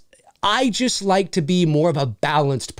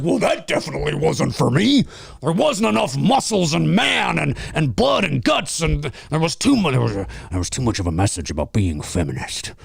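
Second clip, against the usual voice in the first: mock macho critic; 4 semitones lower than usual. Mocking men who hated Barbie, low grumbling sneer. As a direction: lower grumbling bro voice.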